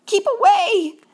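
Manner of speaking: high, loud warning cry. A narrator's reading. Gus warns Mouse off the trap, crying out. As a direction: call out an urgent warning. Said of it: "cried Gus".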